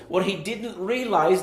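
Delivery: usual speaking voice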